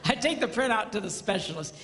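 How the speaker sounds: usual speaking voice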